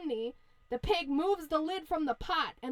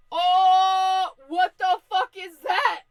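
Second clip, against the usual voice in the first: loud, high, drawn-out alarm; mock terror; 5 semitones higher, 12 dB louder, much slower than usual. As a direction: cry out slowly in exaggerated shock. Wolf sees rolling barrel.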